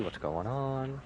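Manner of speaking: ordinary talk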